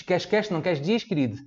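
Santa Catarina coastal accent with hushing S's; Tijucas accent. Shown as a demonstration. Teacher imitating locals from Tijucas, Santa Catarina accent. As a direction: rattle off "queres" with "sh" endings, Tijucas style. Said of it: "É assim".

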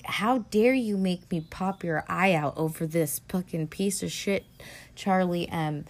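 ordinary talk, nothing marked

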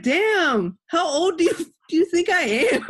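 playfully offended, laughing through it; mock indignant. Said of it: "And I was like".

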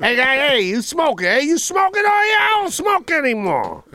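high, rising street-corner holler; construction worker yelling. Acted. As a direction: pester a passerby from the work site. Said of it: "you're one of those construction workers".

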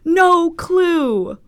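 slow, loud, high wail; aghast emphasis. Real, not acted. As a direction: stretch each word in loud disbelief. Aghast at own blackout.

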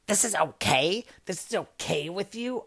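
incredulous questions, rising sharply at the ends; mock indignant customer. Customer challenging the manager's okay, indignant and incredulous. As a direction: imitate an affronted customer challenging you. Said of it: "she goes".

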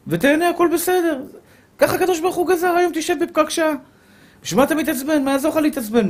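very high, lilting reassurance; cheerful coaxing. Acted. Preacher coaxes an annoyed driver to accept the jam, high lilting voice. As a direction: pitch it way up, as if all is fine.

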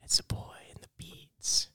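hushed radio DJ announcing; whispered DJ intro, playful. Acted.

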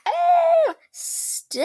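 very high squeal into a breathy cry; excited squeal. Retold or imitated. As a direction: shriek then gasp it out. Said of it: "I was like".

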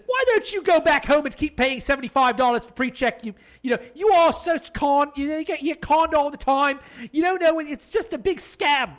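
high, loud Cockney rant; mock the guard's Cockney scolding; imitating Cockney guard. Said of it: "and says".

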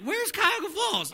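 high, puzzled mock question; mock cluelessness. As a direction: play dumb about the town. Joking he never heard of it.

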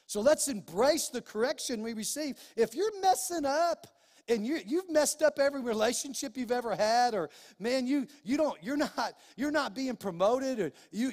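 normal talking voice

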